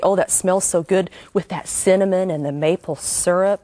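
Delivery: ordinary talk, nothing marked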